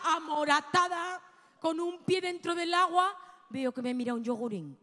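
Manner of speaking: ordinary talk, nothing marked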